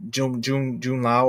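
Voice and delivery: usual speaking voice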